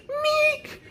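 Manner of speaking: one very high squeaked "meek"; high squeak effect. Retold or imitated. Storyteller imitating a grab by the head, high squeak. Picks this dude up by the noggin.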